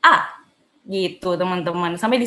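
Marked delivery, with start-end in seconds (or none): 0.0-0.3 A falling; tone four. Shown as a demonstration. Teacher quizzing tone four on A. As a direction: drop A sharply. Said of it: "Dan terakhir?".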